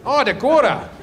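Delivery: put-on high, lilting take on a North Dakota accent. Comic echoing a North Dakotan audience member, teasing North Dakota accent. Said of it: "Same accent".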